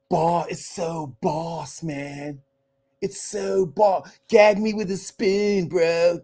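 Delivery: slow, drawn-out mock valley girl; lazy, stretched-out teen slang voice; Valley girl impression. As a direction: ham up an eighties valley girl. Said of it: "the way Caucasian people used to talk".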